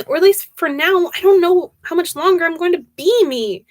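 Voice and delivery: high, acted distress on the phone; panicked outburst. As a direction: voice lifts in alarm.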